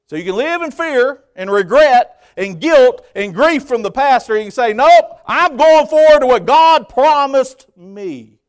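high, loud and drawn out; full-force preaching. Real, not acted. Preacher rejecting the past's grief, full-force preaching. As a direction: preach it slowly and forcefully at the top of the voice.

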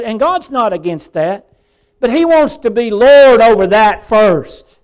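impassioned pulpit delivery; full-force preaching. Real, not acted. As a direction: preach it high and loud, building in force.

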